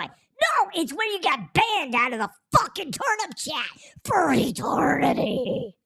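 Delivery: high, loud ranting, stretching the last word; mock angry outburst. Scolding a chat commenter about a ban, loud mock rage. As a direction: blow up theatrically, drawing out the ending.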